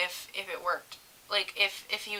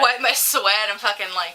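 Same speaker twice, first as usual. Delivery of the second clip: normal talking voice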